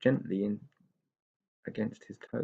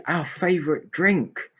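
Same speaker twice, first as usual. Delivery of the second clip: higher, slow happy pixie; joyful; 8 semitones higher, 3 dB louder, slower than usual. Acted. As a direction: savour the favourite. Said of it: "said Tigger joyfully".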